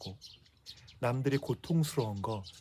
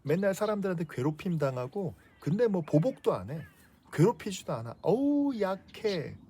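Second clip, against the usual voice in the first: scornful Paul; 8 semitones higher than usual. Retold or imitated. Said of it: "사도 바울은 예수님이 너무 싫었어요".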